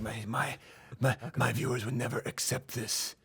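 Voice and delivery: soft, halting action star impression; Steven Seagal. Host mimicking an action star refusing to die, soft impression. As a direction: murmur it as the self-important star. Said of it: "He was like".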